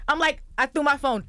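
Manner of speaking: normal talking voice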